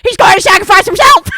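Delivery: put-on raspy old-man yell, loud and high; hammed-up raspy old man shouting; old smoker voice. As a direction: yell it fast like a forty-year smoker. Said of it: "most old man I've smoked for forty years voice".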